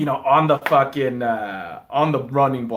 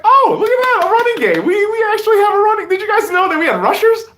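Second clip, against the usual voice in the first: much higher and louder, astonished; mock amazement; 7 dB louder than usual. Host voicing himself gawking at the run game, mock amazement. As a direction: gush like you've never seen a run. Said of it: "it's like".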